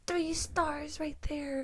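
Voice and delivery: put-on slow, careful little boy voice; quoting a toddler nephew, slow and soft. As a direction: spell it out like a two-year-old. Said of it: "he's like".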